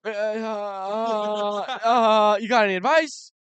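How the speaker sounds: high, loud nervous stammer; nervous fan voice. Retold or imitated. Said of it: "I get all nervous".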